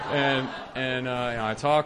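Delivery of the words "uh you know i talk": usual speaking voice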